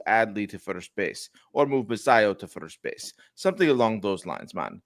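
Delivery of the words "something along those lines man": ordinary talk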